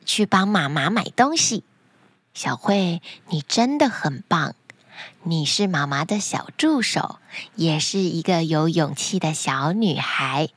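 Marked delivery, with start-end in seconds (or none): 2.3-10.5 low, tender mother voice; praising mother. Acted. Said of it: "妈妈也不吝啬地夸奖小慧".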